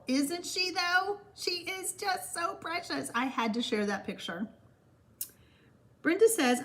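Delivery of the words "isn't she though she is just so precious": gushing grandma. Real, not acted. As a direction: coo it tenderly, pitched up. Gushing over granddaughter's photo.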